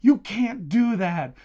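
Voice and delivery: loud, high, slow disbelieving protest; shocked protest. Retold or imitated. Gasping at the book's ending, loudly protesting in mock shock. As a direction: plead at the book as if the author betrayed you. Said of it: "I literally gasped out loud near the end of it, and I said".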